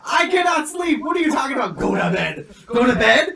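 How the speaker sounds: high, loud panicked shout; panicked yell. Retold or imitated. To girlfriend urging sleep, a high panicked shout. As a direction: yell it like you're mid-trip and losing it. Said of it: "Babe, I'm freaking out".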